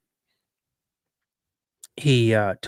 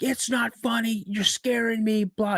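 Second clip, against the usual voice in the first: retold child meltdown; 10 semitones higher than usual. Seven-year-old losing his mind at dad, high retold wail. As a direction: imitate a kid losing it. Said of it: "I'm losing my seven-year-old mind".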